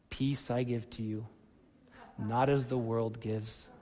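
soft, flat monotone reading. Shown as a demonstration. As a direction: drone it out with no feeling. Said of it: "monotone voice".

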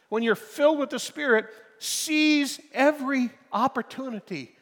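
loud, high, impassioned exhortation; fervent preaching. Acted. Pastor urging the congregation, loud fervent preaching. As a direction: drive it home to the congregation.

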